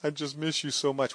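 soft, whimpering mock mourner; mock weeping. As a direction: imitate a mourner with a soft sob in the voice. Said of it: "there's nothing to cry about".

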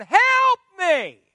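high, loud desperate plea; crying out. Retold or imitated. Preacher showing the church how to cry to God, loud plea. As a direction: call out for help with everything. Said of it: "cry out to God".

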